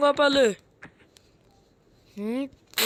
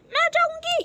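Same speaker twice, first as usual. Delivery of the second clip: put-on much higher girl's voice; girl character voice; 13 semitones higher than usual. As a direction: squeak it out as a little girl. Girl character volunteers to fight. (ऊँची लड़की की आवाज़)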